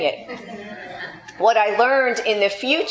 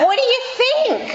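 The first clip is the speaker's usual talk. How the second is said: laughing sarcasm; 14 semitones higher, faster than usual. Pretend. Laughing punchline after boss story.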